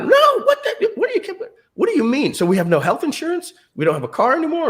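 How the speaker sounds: high, rising panicked questions; imitating a frantic wife; wife's alarmed disbelief.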